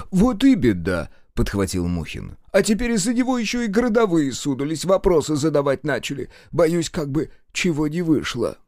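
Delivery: fretful clerk, worrying aloud; nervous official. Acted. Nervous Mukhin's voice.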